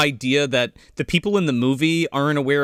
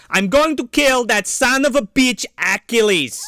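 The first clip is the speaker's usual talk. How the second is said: slightly higher mock Belgian accent; lightly done Van Damme accent; Van Damme quote; 7 semitones higher than usual. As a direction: growl the threat like a foreign action hero. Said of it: "he's like".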